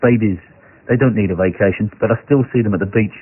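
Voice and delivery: low, deadpan comedian voice; dry, downbeat stand-up delivery; Stephen Wright. Acted. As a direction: deliver the one-liner in a low monotone. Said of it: "I'll do Stephen Wright's voice".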